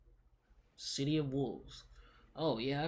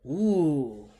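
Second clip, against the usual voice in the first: loud surprised ooh; 11 dB louder than usual. Real, not acted. Still falling through the glitch, a loud surprised ooh. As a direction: let it out as something catches you off guard. Reacting in the game.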